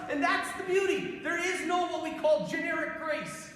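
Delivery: high, impassioned sermon delivery; fervent preaching. Real, not acted. Priest telling the crowd about joy and grace, fervent. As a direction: preach it with rising zeal.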